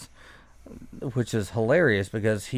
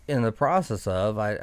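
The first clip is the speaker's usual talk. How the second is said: ordinary talk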